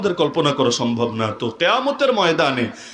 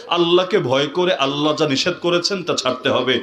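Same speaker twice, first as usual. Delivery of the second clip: normal talking voice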